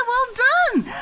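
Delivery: very high, loud, drawn-out cheer; excited praise. Real, not acted. Mum praising her child's animal sound, excited.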